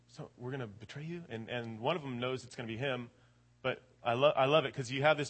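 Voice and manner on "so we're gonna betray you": hushed question. Retold or imitated. Disciples murmur uneasily.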